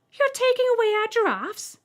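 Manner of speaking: high, loud stunned cry; almost shouting in shock; near shout. Acted. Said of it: "She almost shouted this".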